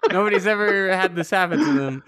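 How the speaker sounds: amused complaint, half laughing; laughing protest. Real, not acted. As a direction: push the words out through a laugh. Laughs while retelling own misfortune.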